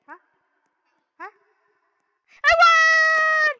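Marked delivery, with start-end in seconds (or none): 2.4-3.5 high, loud cry of triumph; overjoyed shriek of victory; victory shout. Real, not acted. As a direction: scream it like you just won. Winning the level.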